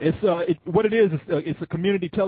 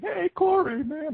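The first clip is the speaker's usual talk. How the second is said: much higher all-caps style greeting; mock yell; 12 semitones higher, slower than usual. Imitating Ryan's caps greeting to Corey, a high mock yell. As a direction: shout the hello high and bright. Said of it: "You're like".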